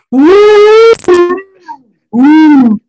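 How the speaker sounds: very high, loud owl hoot. Pretend. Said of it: "Can you do an owl impression?".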